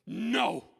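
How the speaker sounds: loud, slow, forceful refusal to quit; defiant determination. Retold or imitated. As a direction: shout it out word by word, determined. Daughter defiantly insists despite pain.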